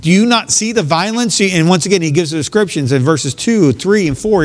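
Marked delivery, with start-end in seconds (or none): 0.0-1.4 high, pleading cry of disbelief; desperate plea to God. Retold or imitated. Preacher voicing a believer's plea to God, high and pleading. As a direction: cry out to God as if he can't see it. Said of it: "and you're like".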